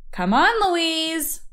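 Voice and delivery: lightly voiced young Fox, sighing along; coaxing Fox. Fox calls his little sister.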